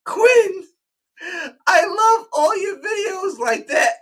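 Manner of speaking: very high, loud gushing squeal; squealing fan. Retold or imitated. Starstruck fan greeting him at the con, squealing.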